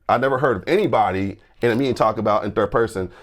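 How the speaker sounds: usual speaking voice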